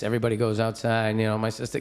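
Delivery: ordinary delivery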